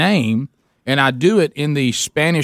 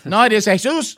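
mock skit reply; 5 semitones higher than usual. Answering voice in mocking skit.

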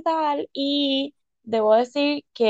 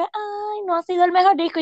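higher-pitched mimicry of picky listeners; mock complaint; 7 semitones higher than usual. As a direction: imitate an unimpressed critic's whine. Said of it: "algunas personas que escuchan como que".

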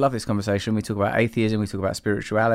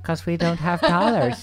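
mock Northern Irish echo, pitched up; Irish accent; 6 semitones higher than usual. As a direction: repeat it in a joking Irish accent. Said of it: "Irish accents".

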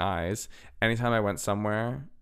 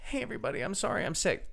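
high, small sickly voice; hammed-up feeble, high-pitched apology; mock apology; 10 semitones higher, faster than usual. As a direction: play up a pitiful excuse to the crowd. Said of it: "wasn't gonna get out there and go".

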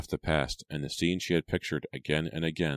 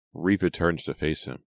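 plain reading voice

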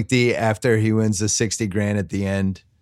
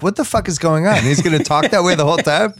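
mock bafflement; 7 semitones higher than usual. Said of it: "in the theater being like".

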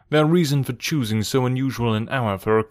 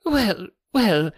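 high, reedy, hesitant stalling; nervous fashion; 8 semitones higher than usual. A narrator's reading. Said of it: "in a nervous fashion".

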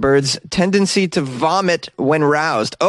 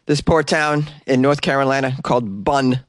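ordinary delivery

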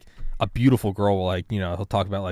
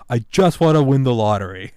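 whiny mock wish; 4 semitones higher, 7 dB louder than usual. Mocking entitled wish, whiny.

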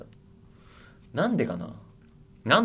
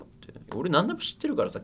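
ordinary delivery

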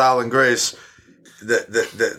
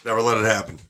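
ordinary talk

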